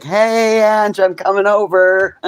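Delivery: high, loud, slow announcer call; loudspeaker announcement. Retold or imitated. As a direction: blare it like a loudspeaker. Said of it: "there was a loudspeaker".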